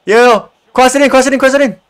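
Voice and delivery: high, insistent repeated call; urgent calling. Real, not acted. Gamer calling to teammate for a cross, urgent calling.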